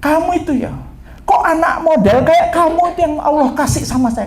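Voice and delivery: high-pitched, exasperated mother; imitated overwrought wail; tearful scolding. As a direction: berate the child through acted tears. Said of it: "Dia sambil nangis, dia bilang begini".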